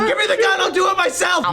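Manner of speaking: shouting. Acted. Acting scene.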